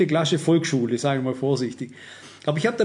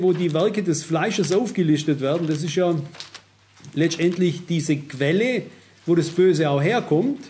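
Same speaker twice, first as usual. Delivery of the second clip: ordinary talk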